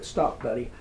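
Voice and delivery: usual speaking voice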